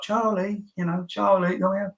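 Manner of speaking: lightly voiced begging prison officer; mock pleading guards. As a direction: wheedle at Charlie like a nervous screw. Guards pleading through cell door.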